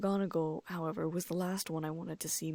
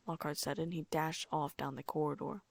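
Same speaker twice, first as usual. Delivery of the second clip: ordinary delivery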